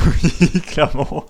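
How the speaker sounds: loud, high, laughing; laughing agreement. Real, not acted. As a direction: agree while cracking up. Laughing agreement with teammate's joke.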